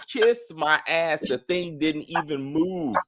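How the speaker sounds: ordinary delivery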